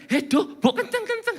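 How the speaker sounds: high-pitched mock-startled protest; acted startled neighbour; startled complaint. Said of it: "Jadi kaget terus".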